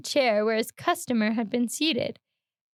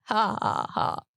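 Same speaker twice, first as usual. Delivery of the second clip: creepy laugh; 3 semitones lower, slower than usual. Acted. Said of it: "What do you mean by it?".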